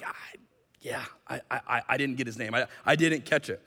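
soft, halting admission; sheepish stammer. Acted. Healed man admits he missed the name, sheepish. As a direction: trip over the words, embarrassed.

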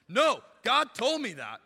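loud, slow, stubborn "No, God told me that"; mock indignant insistence. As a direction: play the offended believer, hammed up. Imitating an offended believer.

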